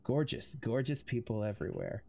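low, intimate purring voice. Acted. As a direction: purr it close, for one listener only. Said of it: "his voice lowered into an intimate purr".

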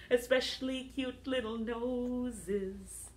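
singsong, rhythmic recital to little ones; playful half-sung nursery rhyme; children's action rhyme. Acted. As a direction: lilt it along, stretching the line ends.

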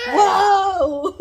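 high laughing whoa; laughing yelp. Real, not acted. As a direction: burst out laughing loudly. Laughing after being stepped on.

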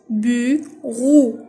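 "Bureau" loud, in two drawn-out halves; split into syllables. Shown as a demonstration. Teacher cutting words into syllables, "Bureau" loudly split in two. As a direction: stretch "Bureau" into "bu", pause, "reau". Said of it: "Je découpe les mots en syllabes".

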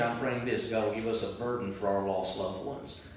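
usual speaking voice

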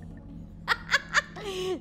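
high breathy giggle. Real, not acted. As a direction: burst into a giggle. Laughs at VTuber joke.

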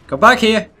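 yelled call after a fleeing enemy; shouting after. Real, not acted. Gamer yelling at a fleeing enemy, loud and high. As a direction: shout it at his back.

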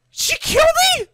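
high, loud, disbelieving question; shocked outcry. Real, not acted. Player finds the attacker killed them, shocked outcry.

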